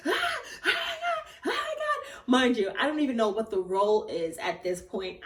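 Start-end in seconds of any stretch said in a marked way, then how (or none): 0.1-2.3 very high, held-in squeal; mock scream of joy, high but not loud; re-enacted squealing disbelief; excited screaming. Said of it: "I was screaming so loud".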